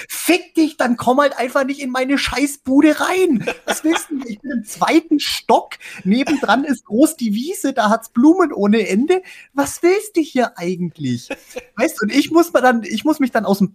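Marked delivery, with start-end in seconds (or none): 0.1-11.3 laut und hoch, empört fluchend; wütend schimpfend. Retold or imitated. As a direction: schimpf das Insekt wütend an. Schimpft innerlich die Insekten an. (wütend schimpfend)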